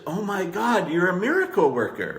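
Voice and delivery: high, gushing praise; thrilled client. Retold or imitated. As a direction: burst out with delighted praise. Thrilled client quoted as example.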